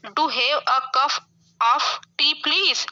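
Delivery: very slow, word by word, for students to write down; slow dictation. Shown as a demonstration. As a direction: spell it out slowly so the class can copy it.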